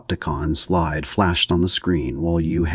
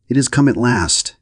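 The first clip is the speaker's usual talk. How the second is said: higher and louder, a read shriek of relief; 7 semitones higher, 5 dB louder than usual. A narrator's reading. Hostess to her guests, a narrated shriek of joy. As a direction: cry it out in a thrilled shriek. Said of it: "Missus Morier shrieked".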